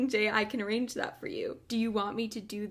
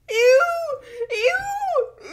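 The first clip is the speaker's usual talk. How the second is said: squealing ew through laughter; disgusted laugh; 9 dB louder than usual.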